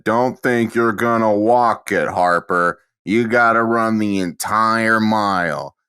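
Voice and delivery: slow, firm coach voice; stern coach. Retold or imitated. Coach telling a student to run the whole mile, slow and stern. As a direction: lay down the law like a gym coach. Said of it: "he caught me and he said".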